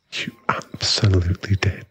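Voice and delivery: a hushed, knowing whisper. Acted. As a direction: breathe it close to their ear. Insisting, leaning in close.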